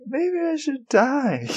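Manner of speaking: squeaky, slow overdramatic moan; hammed-up high, slow gloom; high, slow mock despair. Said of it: "she's kinda just like".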